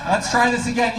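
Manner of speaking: ordinary talk, nothing marked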